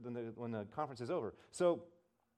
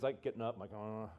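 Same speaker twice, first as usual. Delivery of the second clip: ordinary talk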